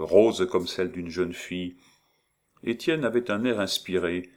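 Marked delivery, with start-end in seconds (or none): none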